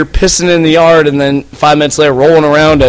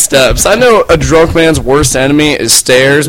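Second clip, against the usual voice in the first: ordinary delivery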